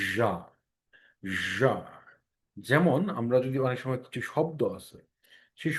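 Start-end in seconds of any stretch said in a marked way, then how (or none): 0.1-1.7 জা with a buzzing zh in the throat; voiced zh sound. Shown as a demonstration. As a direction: buzz the tongue and throat on জা. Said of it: "জ কিন্তু একটা vibration হবে". (জিহ্বা ও গলায় কম্পন দিয়ে জা)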